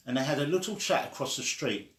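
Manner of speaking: ordinary delivery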